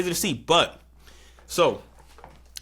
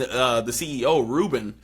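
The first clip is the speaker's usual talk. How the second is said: ordinary talk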